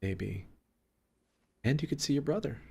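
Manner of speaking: soft, sheepish and halting. Acted. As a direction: speak softly, awkwardly offering to make peace. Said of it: "sheepishly, and says".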